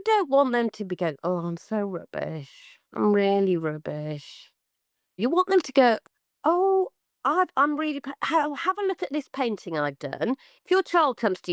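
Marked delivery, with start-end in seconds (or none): 1.2-4.5 mope it out like a kid who thinks it's bad; deflated self-put-down. Child running down own work. 6.4-10.4 put-on much higher, bright eager child voice; proud and excited. Child showing a parent a new painting, high and excited.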